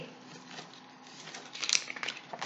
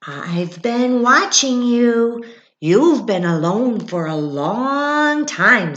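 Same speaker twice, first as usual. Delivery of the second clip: higher, loud and slow chipmunk; 5 semitones higher, 7 dB louder, slower than usual. Acted. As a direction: lean in slowly, loud and curious. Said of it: "said the chipmunk".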